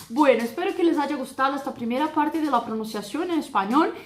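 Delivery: ordinary delivery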